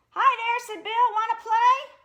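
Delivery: high, loud little bunny voice; cheery bunny. Acted. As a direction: bounce in eager to play.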